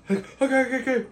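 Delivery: high, quick placating voice; high-pitched hurried surrender; cowed prisoner. Acted. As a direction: give in nervously. Prisoners hush after intimidation.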